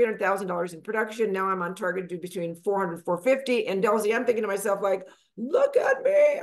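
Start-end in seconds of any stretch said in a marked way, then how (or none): 5.4-6.4 high, slow, loud crowing; shouted self-congratulation; excited. Retold or imitated. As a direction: crow it out, thrilled with yourself. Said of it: "I was so excited".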